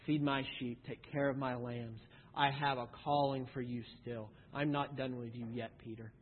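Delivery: soft, low and gentle; tender reassurance. Acted. Jesus recommissioning Peter, soft and low. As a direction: reassure him quietly.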